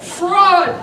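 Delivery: loud, high crowd cry of protest; angry cry. A narrator's reading. The maddened crowd protesting the call, loud cry. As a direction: hurl it at the umpire. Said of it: "cried the maddened thousands".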